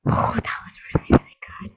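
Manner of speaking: airy, overwhelmed almost-whisper; breathy awe. Real, not acted. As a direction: breathe it out, moved. Overwhelmed by the song.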